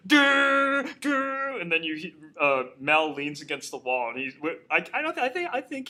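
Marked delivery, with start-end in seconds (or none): 0.1-1.6 high-pitched sung-out "doo" sting; imitated music sting. Host imitates the movie's music for friends, high dramatic sting. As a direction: mimic a dramatic score stab, drawn out. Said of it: "the music's like".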